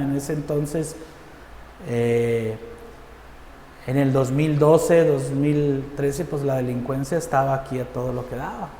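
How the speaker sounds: ordinary talk, nothing marked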